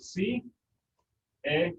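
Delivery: "si", "e", "lo" separated by long pauses; syllable by syllable. Shown as a demonstration. Teacher asking which syllable is accented, syllable by syllable. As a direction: lay out "si", "e", "lo" one at a time. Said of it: "that gets the accent".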